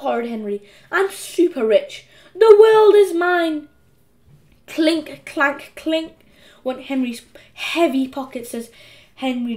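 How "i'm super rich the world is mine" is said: slow, louder, higher exulting; gloating triumph. A narrator's reading. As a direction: savour every word in triumph. Henry gloats over money.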